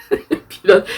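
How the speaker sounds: giggling through the word; laughing. Real, not acted. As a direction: burst out laughing mid-word. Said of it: "ouais, ça va piloter votre vie".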